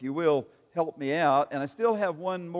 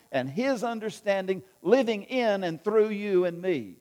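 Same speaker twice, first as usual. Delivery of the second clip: preaching; 3 semitones higher than usual. Acted. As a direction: lift it like the sermon's climax. Preaching climax.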